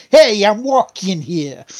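mock New Yorker accent; exaggerated New York accent, as a movie-style quote. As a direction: imitate a brash New York street accent. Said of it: "probably trying to New York".

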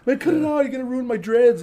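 high-pitched mock protest; annoyed complaint. Friend worried about his dreads, annoyed complaint.

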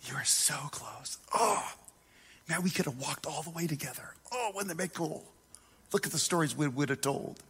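breathy, hushed, tender whisper; whispered Jesus. Acted. Jesus gently whispering to Peter after he sinks. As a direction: whisper it close, fond and wistful. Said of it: "He says".

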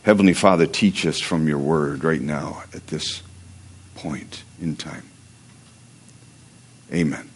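soft, hushed prayer voice. Acted. Short prayer.

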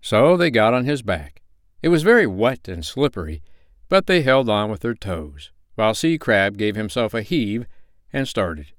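plain reading voice

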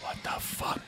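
barely audible shocked reaction; very soft, breath-only question; scared whisper. Retold or imitated. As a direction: whisper it as if someone's in the house. Heart pounding, retold scared reaction.

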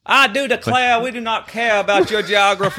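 mock-indignant Southern accent. Host scolding co-hosts over geography, in mock Southern. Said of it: "supposed to be doing accents".

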